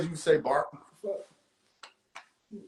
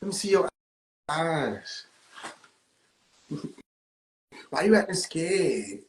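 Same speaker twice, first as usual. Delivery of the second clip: slow mocking taunt; 3 semitones lower, 4 dB quieter, slower than usual.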